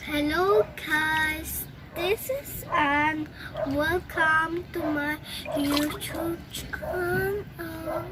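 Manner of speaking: slow, drawn-out soft greeting; six-month student demo. Shown as a demonstration. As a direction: stretch every word out gently, as a trained-voice demo. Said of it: "ganito ang boses ko after six months ng voice lesson".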